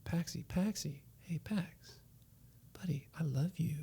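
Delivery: soft whispering comfort; father gently soothing his upset son; soothing dad. Retold or imitated. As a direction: whisper love to calm the child. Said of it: "I was like".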